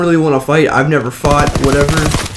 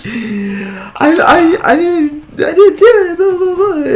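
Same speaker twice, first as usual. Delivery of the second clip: sobbing; slower than usual. Retold or imitated. In the principal's office, high wailing crying denial. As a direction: cry it out in high wails. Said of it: "start crying".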